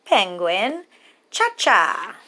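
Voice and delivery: usual speaking voice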